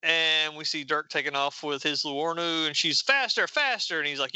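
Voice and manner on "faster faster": high-pitched, eager egging-on; excited urging. Acted. Rider urging Dirk on, excited and higher. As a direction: play an excited passenger spurring the driver on.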